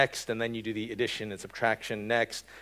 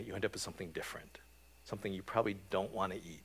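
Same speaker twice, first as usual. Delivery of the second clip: quick whisper; 4 semitones lower, 11 dB quieter, faster than usual. Real, not acted. Hushed quick aside.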